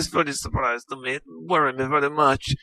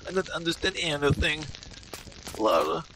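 put-on broken English in a heavy Russian-style accent; bewildered Russian-accented gamer; mock Eastern European accent; 6 semitones higher, 3 dB quieter than usual.

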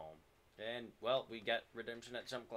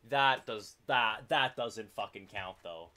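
indignant protest; 9 dB louder, slower than usual. Real, not acted. Protesting an unfair loss.

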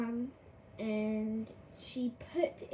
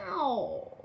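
a yelped cat meow; startled cat cry; 4 dB louder than usual. Pretend. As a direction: squeal like a cat whose tail got stepped on. Cat's tail trodden on.

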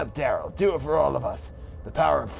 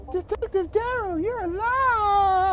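high woman's voice; 3 dB louder, slower than usual. Acted. Woman cheers detective alive.

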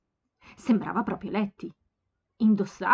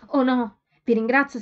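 loud, halting flustered refusal; flustered stammer; 4 semitones higher, 7 dB louder than usual. A narrator's reading. Young woman stammering a refusal to a stranger, loud and flustered. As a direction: stumble over the thanks, embarrassed. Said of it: "balbettò Sophie".

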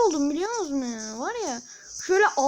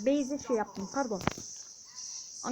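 ordinary talk

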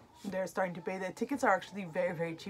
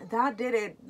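choked up; 4 semitones higher, 5 dB louder than usual. Real, not acted. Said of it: "I was holding it".

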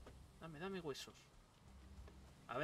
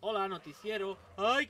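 ordinary talk

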